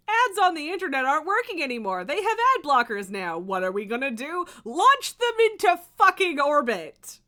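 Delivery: loud, indignant mock questioning building to a shout; outraged, climbing to a yell; angry rant. Real, not acted. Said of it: "That makes me so angry".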